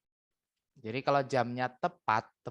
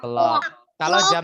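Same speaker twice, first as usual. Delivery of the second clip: loud echo; 11 dB louder than usual. Shown as a demonstration. Teacher echoes loud to class.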